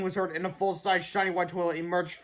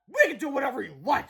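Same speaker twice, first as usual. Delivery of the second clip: higher, sneering defiance; scornful retort from a mean teacher; snarled; 8 semitones higher than usual. A narrator's reading. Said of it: "snarled Mister Meter".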